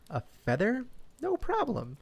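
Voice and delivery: bright, high little duck. A narrator's reading. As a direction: quack it out high. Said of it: "she said".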